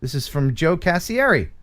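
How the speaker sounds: ordinary talk, nothing marked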